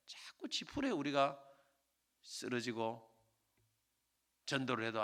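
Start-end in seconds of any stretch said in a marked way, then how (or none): none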